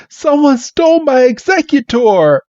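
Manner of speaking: high-pitched mock alarm; acted wail of alarm; panicked victim. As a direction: imitate a distraught theft victim, voice held very high. Trainer reports a theft.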